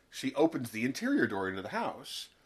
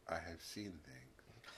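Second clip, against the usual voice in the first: much softer, slow and hollow; mock haunted murmur; 5 semitones lower, 18 dB quieter, slower than usual. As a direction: say it as if the horror still holds her. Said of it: "And she's just like".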